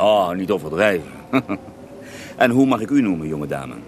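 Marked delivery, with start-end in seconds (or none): 1.2-2.3 short breathy amused chuckle; a quiet knowing laugh; soft unvoiced chuckle. Rasputin amused by flattery.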